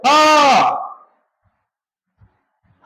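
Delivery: ह said alone as a clear breathy h from the throat; isolated demo of ह, breathy and deliberate; sounding out ह. Shown as a demonstration. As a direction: say ह slowly and on its own so the class hears the throat sound. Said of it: "नहीं तो ह बोल के देखो".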